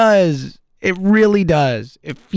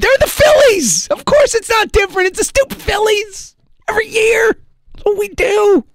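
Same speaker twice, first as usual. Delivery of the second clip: angry rant. Real, not acted. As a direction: vent real fury at the hopeless team. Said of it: "I'm so angry right now".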